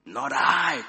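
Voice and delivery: loud, emphatic refusal; cried refusal. A narrator's reading. Cave dweller refusing to step closer, loud cry. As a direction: cry out your refusal loudly. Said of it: "cried one voice".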